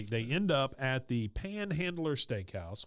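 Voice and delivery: ordinary delivery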